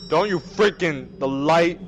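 usual speaking voice

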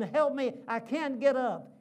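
loud, high pleading cry of a pinned man; crying out for help. Retold or imitated. Wrestler pinned by his son cries for help, loud and high. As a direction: call out for help, hammed-up and playful. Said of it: "And his daddy's saying".

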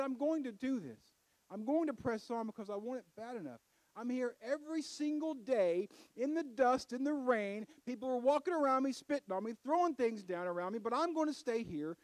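loud and high, a blind beggar refusing to be silenced; defiant cry. Acted. Bartimaeus answering the crowd, loud and defiant. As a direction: shout it out, planting your feet.

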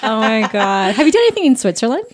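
ordinary talk, nothing marked

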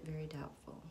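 whispered verdict; soft whispered reading. Real, not acted. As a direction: breathe the answer out. Reading spirit's answer again.

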